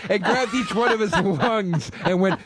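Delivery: slow, high mock fury; worked-up imitation. College friend relishing his hate fantasy, mock worked-up. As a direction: act out the friend's fantasy, drawn out. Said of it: "he would get so worked up".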